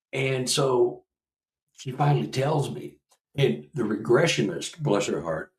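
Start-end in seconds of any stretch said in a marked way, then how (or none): none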